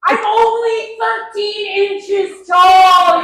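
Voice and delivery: shouted wail. Acted. Kid wail.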